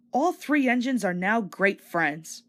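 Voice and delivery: ordinary delivery, nothing marked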